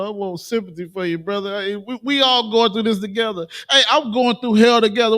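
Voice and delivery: hammed-up fake sorrow; fake sympathy. Speaker mimicking the oppressor's fake tears, hammed-up. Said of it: "He gonna cry like".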